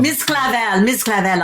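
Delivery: lightly French-accented character voice; name it with a French lilt. Shown as a demonstration. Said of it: "So that was a French accent".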